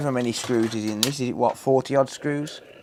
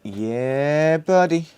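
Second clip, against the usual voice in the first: excited cheer; 4 semitones higher, much slower than usual. Real, not acted. Celebrating, higher and louder.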